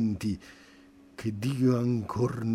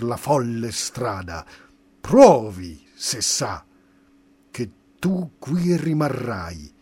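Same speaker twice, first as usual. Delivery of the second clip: sdegno minaccioso; 7 dB louder than usual. Acted. Demoni minacciano il poeta.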